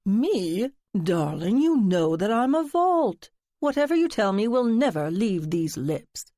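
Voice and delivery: flamboyant, mock-offended vow of secrecy; theatrical friend. Friend promising narrator he can keep a secret, hammed-up. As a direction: play the drama queen swearing he's a vault.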